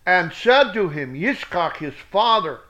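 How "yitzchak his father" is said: ordinary delivery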